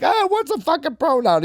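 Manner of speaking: high-pitched mock bafflement; exasperated dad. Father answering his child about pronouns, mock exasperated. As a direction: imitate a dad losing patience. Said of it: "He's like".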